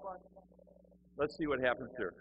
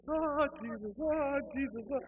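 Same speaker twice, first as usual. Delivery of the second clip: high-pitched, wailing church lady; frantic praying lady; 12 semitones higher, 3 dB louder than usual. Retold or imitated. Lady kneeling beside him cries out to Jesus, high mock fervour. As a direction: wail it out high, imitating a fervent worshipper.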